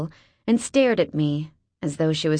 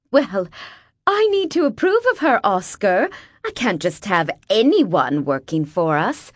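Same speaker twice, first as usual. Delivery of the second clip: haughty rich wife; 3 dB louder than usual. A narrator's reading. Haughty wife insisting to husband.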